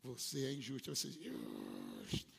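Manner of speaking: very low, slow devilish voice; deep slow sneering devil. Acted. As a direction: drag it out low like the devil accusing you. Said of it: "O diabo diz".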